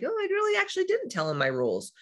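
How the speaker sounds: high, sing-song dawning realization; a little voice admitting she's wrong; sheepish realization. Retold or imitated. Realizing mid-fight she never told Matt, sheepish and high. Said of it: "But then I'm like".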